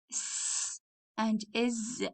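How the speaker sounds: s and is, slow and soft; slow sound demo. Shown as a demonstration. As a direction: spell out s and is slowly. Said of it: "we have some sounds here".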